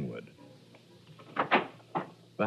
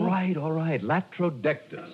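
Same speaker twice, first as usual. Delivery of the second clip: exasperated; 11 semitones higher than usual. Acted. Exasperated giving in.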